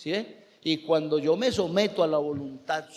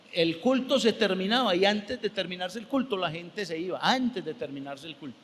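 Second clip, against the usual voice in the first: ordinary delivery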